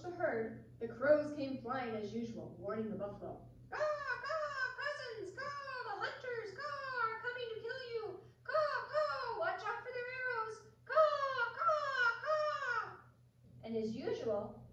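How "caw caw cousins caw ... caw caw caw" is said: high cawing crow voice; crow cry. Acted. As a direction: call out like a warning crow. Crow warning buffalo.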